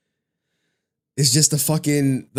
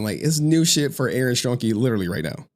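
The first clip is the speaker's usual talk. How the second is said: ordinary talk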